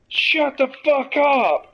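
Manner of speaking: high, drawn-out yell at the ducks; exasperated yell. Retold or imitated. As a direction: stretch it out in a strained, fed-up scream. Yelling at noisy ducks, fed up.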